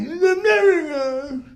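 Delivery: high, slow, tearful mock gush; gushing "it's a miracle". Crowd at a baby party gushing in a high, slow, tearful voice. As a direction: ham up a weepy miracle cry.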